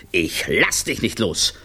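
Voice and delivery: plain reading voice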